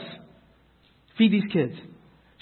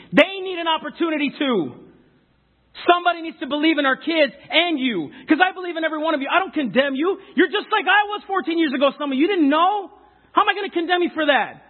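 raised, fervent preaching voice; 8 semitones higher than usual. Acted. A preacher urges his congregation, fervent raised preaching. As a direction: preach it up with rising conviction.

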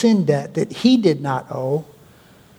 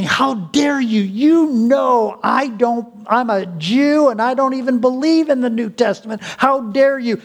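indignant outburst; 7 semitones higher than usual. Retold or imitated. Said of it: "He was thinking".

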